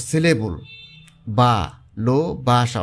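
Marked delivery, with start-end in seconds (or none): 1.4-2.8 বা, লো, বাসা said as separate beats; syllable by syllable. Shown as a demonstration. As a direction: pause between the syllables of "বা লো বাসা". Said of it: "শব্দটিকে ভাঙলে চারটা অক্ষর".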